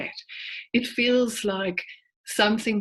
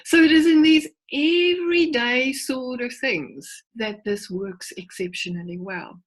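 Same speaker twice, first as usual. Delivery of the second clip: ordinary talk, nothing marked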